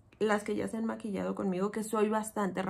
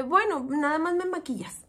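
curt reply; 5 semitones higher, 3 dB louder than usual. Retold or imitated. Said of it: "pero así".

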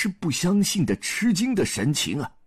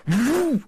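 imitated swing of a heavy staff; high whoosh; 9 semitones higher than usual. A narrator's reading. Staff swings at Zhu Bajie, high imitated whoosh. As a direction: voice a rising whoosh.

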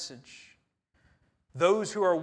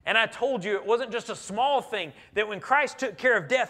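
normal talking voice

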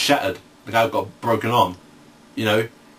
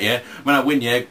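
ordinary talk, nothing marked

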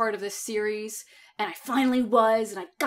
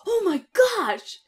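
high, loud gasp of fan excitement; imitating thrilled fans, high and slowed at the end; excited fans; 10 semitones higher, 5 dB louder, slower than usual. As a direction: squeal it like a starstruck fan. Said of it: "they're like".

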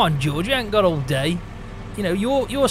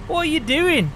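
calling out; 9 semitones higher than usual. Real, not acted. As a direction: yell it out to the driver ahead. Calling out to slow scooter driver.

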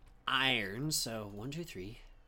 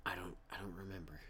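whisper; 6 semitones lower, 10 dB quieter, faster than usual. Real, not acted. Whispered aside.

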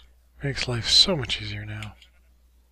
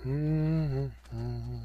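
normal talking voice

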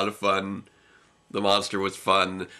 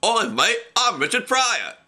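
high, loud, silly put-on accent; Richard Pryor, botched impression; 14 semitones higher, 4 dB louder, slower than usual. Co-host mocking a failed comedian impression, botched accent. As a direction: call it out as a hammed-up bad impression. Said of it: "come out Scottish".

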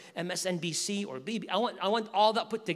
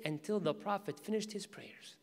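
hushed whisper; 9 dB quieter than usual. Retold or imitated. Umar listening hidden at Kaaba.

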